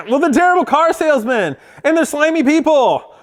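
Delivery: high-pitched, laughing indignation; mock-indignant retort. Storyteller answering imagined commenters, mock indignant. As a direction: fire back in exaggerated protest.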